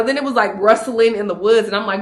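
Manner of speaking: usual speaking voice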